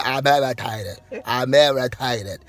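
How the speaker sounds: lightly mocked gripe, "irritated" stretched; mild parody of a put-out sister; imitating irritated sister. Brother recaps sister's complaint for viewers, mildly mocking. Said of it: "outside of the fact that".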